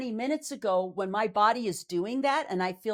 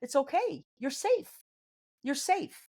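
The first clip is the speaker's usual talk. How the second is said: self-reassurance; 5 semitones higher than usual. Retold or imitated. Reassuring herself, voice lifted.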